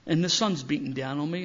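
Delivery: ordinary delivery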